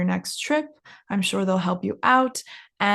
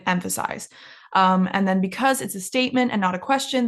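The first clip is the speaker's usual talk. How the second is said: usual speaking voice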